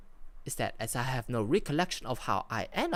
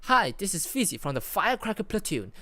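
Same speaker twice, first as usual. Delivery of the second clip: higher, louder walkie-talkie call; radio call-out; 6 semitones higher, 5 dB louder than usual. Acted. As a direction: call in over the radio, voice lifted.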